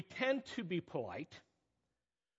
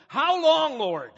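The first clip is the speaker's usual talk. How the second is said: high, loud anguished cry; 10 semitones higher, 11 dB louder, slower than usual. Shown as a demonstration. Preacher showing the congregation how to cry out, loud anguished cry. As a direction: fling the question up to God. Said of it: "maybe we ought to cry out".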